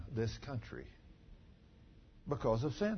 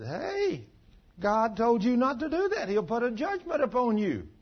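stern rebuke; 9 semitones higher than usual. Retold or imitated. Preacher scolding young man.

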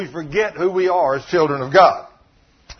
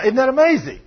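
ordinary delivery

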